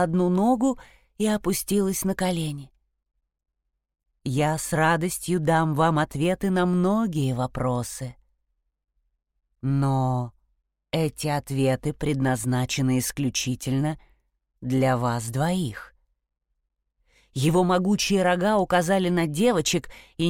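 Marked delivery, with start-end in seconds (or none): 4.2-15.9 solemn stag voice. Acted. The stag answers gravely.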